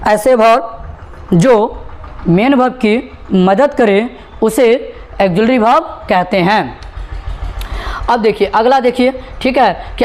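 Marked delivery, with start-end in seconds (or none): 0.1-6.8 very slow, word by word, for students to repeat; slow teacher dictation. Shown as a demonstration. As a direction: spell it out slowly so the class can follow. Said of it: "मेरे साथ बोलिएगा". (धीरे धीरे, बोलकर दोहराने के लिए)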